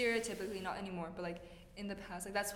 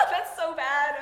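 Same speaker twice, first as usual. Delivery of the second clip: laughing; 8 semitones higher, 16 dB louder, slower than usual. Real, not acted. Friends laughing over dating type.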